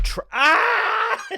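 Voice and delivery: high shouted yelp; a sudden pained cry. Acted. Cutting off his own line with a high yelp.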